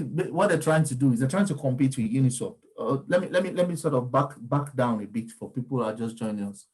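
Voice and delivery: ordinary talk, nothing marked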